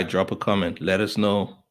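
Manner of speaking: normal talking voice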